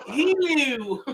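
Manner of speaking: Hulu drawn out Baltimore-style, laughing; mock Baltimore Hulu through laughter; Baltimore accent. Host mimicking Baltimore speech, laughing Baltimore accent. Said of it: "That's how they be in Baltimore".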